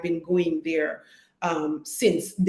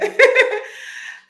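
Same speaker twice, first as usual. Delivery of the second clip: loud high laugh breaking out; a real laugh at not knowing the time; high burst of laughter; 8 dB louder than usual. Real, not acted. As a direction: laugh it off, caught unsure. Laughs at own uncertainty.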